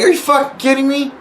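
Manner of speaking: high-pitched retold indignation; incredulous outburst. Player calling out a fellow player, incredulous. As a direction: challenge them in sheer disbelief.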